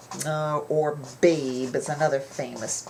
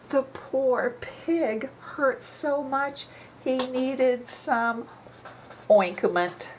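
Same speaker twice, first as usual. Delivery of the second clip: pitched up, slow sympathetic storyteller; teacher acting out pity for the hurt pig, slow and high; mock pity for a pig; 7 semitones higher, slower than usual. Shown as a demonstration. Teacher demoing a pun to students, slow mock sympathy. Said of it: "I might say".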